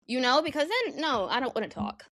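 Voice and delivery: ordinary delivery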